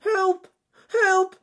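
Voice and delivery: very high, loud cry for help; rabbit shouting for help. Acted. Trapped rabbit calls the other animals, high loud shout. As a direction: call out desperately, high and loud. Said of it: "shouted Ernest Fluffy Rabbit".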